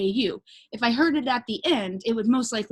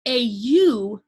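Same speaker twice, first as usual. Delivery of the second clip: ordinary delivery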